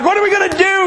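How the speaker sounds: very high-pitched comic alarm; feigned panic. Retold or imitated. Driver playing along with the scared manager, feigned panic. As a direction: pitch it way up, hamming up helplessness.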